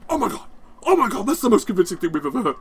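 high, gushing imitation of impressed producers; mock amazement. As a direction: gush in exaggerated awe. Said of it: "They're like".

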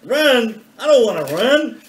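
alarmed protest. Acted. Mister Allen protesting advice.

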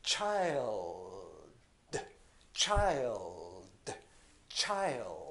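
put-on child ending with the tongue held on the gum ridge; 舌を動かさない child; dark-L child. Shown as a demonstration. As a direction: keep the tongue still at the end of child. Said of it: "ここでこういう感じです".

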